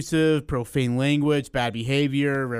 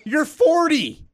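high whiny protest; mock incredulous teenager; indignant teen; 12 semitones higher, 4 dB louder, slower than usual. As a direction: squeal it like an outraged kid. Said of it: "I was like".

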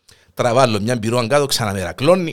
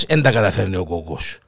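ordinary talk, nothing marked

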